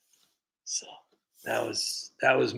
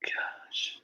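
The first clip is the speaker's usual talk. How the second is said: a breathy whispered sigh; whispered gosh; 10 dB quieter than usual. Real, not acted. Streamer to chat, whispering wistfully. Nostalgic aside.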